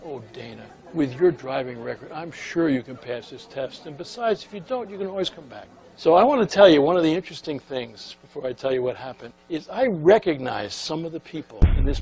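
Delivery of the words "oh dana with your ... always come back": soft, soothing voice; gentle reassurance. Retold or imitated. Clerk patting the secretary's hand across the counter, softly reassuring. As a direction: quietly comfort a nervous test-taker. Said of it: "patted her hand".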